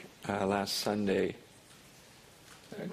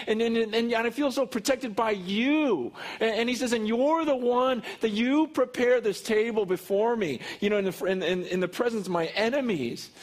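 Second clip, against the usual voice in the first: much higher and louder, fast and fervent; excited preaching; 11 semitones higher, 5 dB louder than usual. Acted. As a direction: preach it with rising joy and energy.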